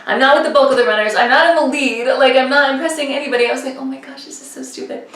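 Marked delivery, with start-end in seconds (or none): 3.8-5.1 very soft, near-whispered grumble; muttered retold frustration. Racer talking to herself mid-race, muttered frustration. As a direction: grumble it under your breath.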